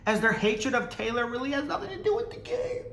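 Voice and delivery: high voice climbing into a squeal, breaking into laughter; mocking read-out. Reading tweet in mocking squeal.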